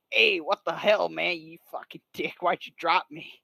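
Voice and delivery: hammed-up indignant cartoon tough guy; loud mock outrage in a put-on voice; bad Joey Wheeler. Falling Pikachu berates Ash, bad Joey Wheeler impression. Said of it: "kinda like a bad Joey Wheeler impression".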